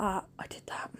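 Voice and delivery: whispered pride; whispered triumph; proud whisper. Retold or imitated. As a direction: breathe it out with quiet triumph. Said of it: "I was like".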